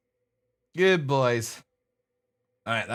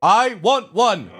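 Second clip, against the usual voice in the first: loud, high, drawn-out words; loud and proud; 8 semitones higher, 8 dB louder, much slower than usual. Shown as a demonstration. Owner teaching husky to talk, loud and proud. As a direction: belt out each word slowly. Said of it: "Say it loud and proud".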